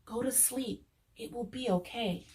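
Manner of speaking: soft, drowsy whisper; sleepy whisper. A narrator's reading. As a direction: hush a friend to sleep. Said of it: "whispered a sleepy Little Fox".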